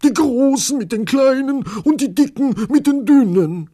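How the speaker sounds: very high, loud wail; frantic, high-pitched complaint; desperate conductor. A narrator's reading. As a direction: cry out at wits' end. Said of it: "sagte der Schaffner verzweifelt".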